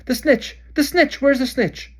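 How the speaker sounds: high, loud searching bellow. Acted. Charlie bellowing along the row to find the snitch. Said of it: "bellowed Charlie along the row".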